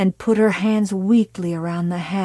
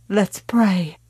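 plain reading voice

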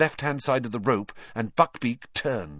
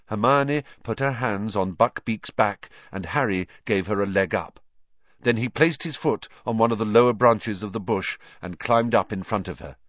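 plain reading voice

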